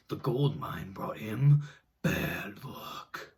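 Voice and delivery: ordinary delivery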